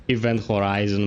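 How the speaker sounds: ordinary talk, nothing marked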